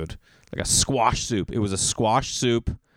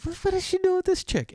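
shrill high disbelief, much higher; incredulous. Retold or imitated. Jealous guy at bus stop, squeaky disbelief.

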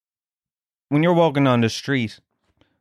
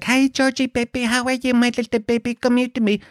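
high-pitched gushing pet voice; cat baby talk; 11 semitones higher than usual. Retold or imitated. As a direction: fuss over a little cat in squeaky baby talk. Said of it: "I hate how I talk to my cat".

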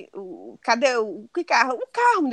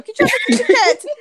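laughing mock shout; 11 semitones higher, 6 dB louder than usual. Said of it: "Aí eu".

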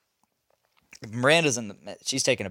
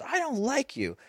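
put-on much higher, softer childish whine; imitating a crying kid; 13 semitones higher, 7 dB quieter than usual. Dad imitating his crying stepson, higher and softer. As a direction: mimic a tearful pouting boy. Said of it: "He goes".

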